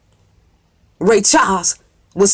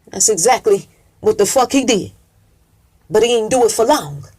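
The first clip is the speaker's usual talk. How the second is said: usual speaking voice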